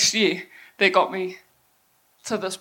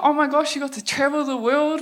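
put-on higher-pitched gushing young fan; imitated excitement; 5 semitones higher than usual. Young people admiring her travels, imitated excitement. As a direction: gush like an impressed youngster, lightly mocked. Voicing impressed young fans.

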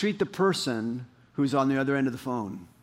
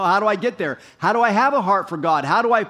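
raised, higher, louder pleading questions; preaching at full force; 7 semitones higher, 8 dB louder than usual. Acted. Preacher urging the church to seek God, full-force preaching. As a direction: press the congregation hard with each question.

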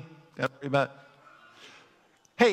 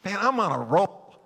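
ordinary talk

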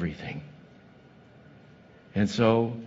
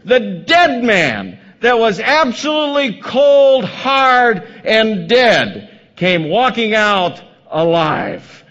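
loud, higher, slowed preacher build; preaching at full force; 7 semitones higher, 6 dB louder, slower than usual. Acted. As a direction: hammer each line of the story home.

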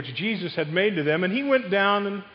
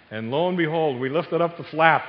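normal talking voice

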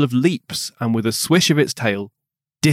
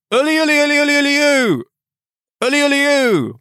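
high rippling "uli-uli" holler with long oo; pitched-up, sustained huntsman's call; cried hunting call; 12 semitones higher, faster than usual. A narrator's reading. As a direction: cry out to the hounds. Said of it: "he cried".

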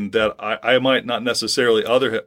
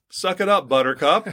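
playful higher-pitched scolding; mock tough love; 6 semitones higher than usual. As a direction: jokingly tell a whiner to toughen up. Blunt paraphrase of God's rebuke.

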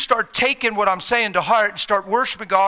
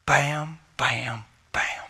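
bam bam bam, whispered and unvoiced; 4 semitones lower, 6 dB quieter, much slower than usual. A narrator's reading. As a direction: hush each bam to a whisper. Hushed recap of encounters.

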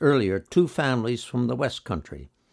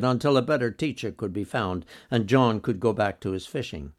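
usual speaking voice

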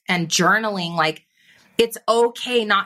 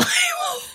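a much higher pitched laugh, almost crying; high squealing laugh; 3 dB quieter than usual. Real, not acted. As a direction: burst out laughing at the memory. Bursts out laughing about podcast.

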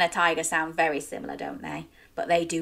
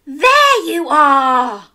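sighing mom; 6 semitones higher, 9 dB louder, much slower than usual. Acted. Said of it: "she sighed".

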